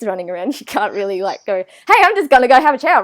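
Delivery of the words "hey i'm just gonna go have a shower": put-on loud, fast call to the kids. Mum calling to her kids before a shower, loud and quick. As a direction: shout it across the house.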